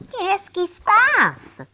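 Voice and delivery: high, slow puzzled voice; puzzled granny. A narrator's reading. Grandmother asks what happens, slow puzzled. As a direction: ask in confusion.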